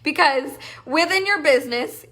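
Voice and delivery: ordinary talk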